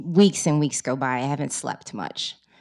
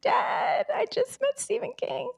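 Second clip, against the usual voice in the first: excited. Retold or imitated. Retells excited call to dad.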